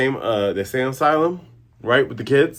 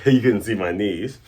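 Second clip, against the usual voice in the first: usual speaking voice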